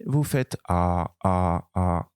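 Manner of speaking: "A" drawn out slow and low; slow A drill. Shown as a demonstration. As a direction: drill "A" slowly, mouth wide. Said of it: "comme ça". ("A" lent et bien ouvert)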